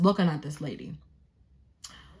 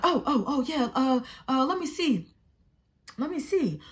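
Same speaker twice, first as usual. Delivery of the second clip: higher-pitched mock helper; flustered bystander; 5 semitones higher than usual. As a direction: imitate someone suddenly remembering to help.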